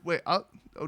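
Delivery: ordinary delivery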